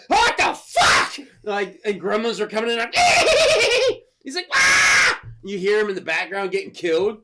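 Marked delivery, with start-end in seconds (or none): 0.1-1.3 high, drawn-out shocked yell; hammed-up shriek of alarm; mock panicked shout. Acting out a guy attacked by gremlins, mock panicked shout. 3.0-4.0 loud high-pitched imitated cackle; shrieking mock monster giggle; gremlin cackle. Imitating gremlins coming in, high cackling shriek. 4.5-5.2 high, loud imitated scream; hammed-up scream of terror; mock scream. Acting out a man getting killed by gremlins, mock scream.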